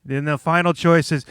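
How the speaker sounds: ordinary talk, nothing marked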